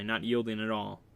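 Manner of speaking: ordinary delivery, nothing marked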